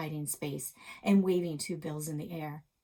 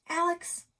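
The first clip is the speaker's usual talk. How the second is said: eager call; 11 semitones higher, 6 dB louder than usual. Acted. Narrator calling to her friend.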